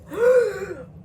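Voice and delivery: loud startled gasp, pitched high; a sudden high gasp; sharp gasp. Retold or imitated. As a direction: gasp as if choked. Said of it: "All you hear is".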